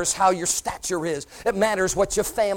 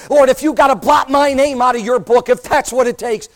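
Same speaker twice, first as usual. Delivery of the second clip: louder, higher plea to the Lord; impassioned cry; 5 semitones higher, 7 dB louder than usual. Acted. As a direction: cry out willing to be blotted.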